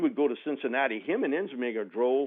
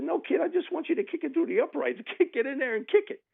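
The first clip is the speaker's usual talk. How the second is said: exasperated coach. Retold or imitated. Exasperated coach orders kicker.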